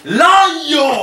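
loud laughing shout. Real, not acted.

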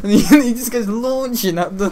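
laughing through the words; giggling mid-sentence. Real, not acted. Laughing while telling story.

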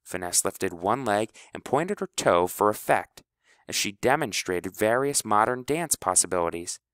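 usual speaking voice

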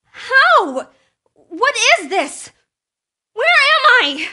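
high, loud frantic questions; panicked shouting. Acted. Frantic character in strange place.

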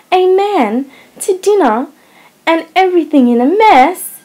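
wife appalled that a guest is coming, outraged; indignant dismay. A narrator's reading. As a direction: cry out in shocked reproach. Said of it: "a tone of mingled indignation, reproach, and dismay".

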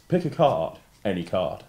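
grand showman patter voice; theatrical magician. Shown as a demonstration. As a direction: announce it like a stage conjurer. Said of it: "say to me in your best magician's voice".

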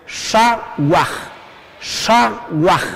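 "shawaj" split into "sha" and "waj", a gap between; syllable by syllable. Shown as a demonstration. Teacher leading learners to say it together, syllable by syllable. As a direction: break "shawaj" in two for learners to echo. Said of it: "A ver, junto".

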